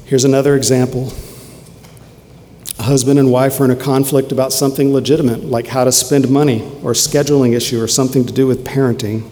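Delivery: ordinary delivery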